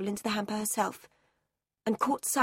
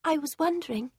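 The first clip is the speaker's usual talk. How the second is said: put-on higher, soft, calm little-girl answer; a lightly voiced girl answering steadily; Sarah to the headmistress, soft and high; quiet girl; 5 semitones higher than usual. A narrator's reading. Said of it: "answered Sarah".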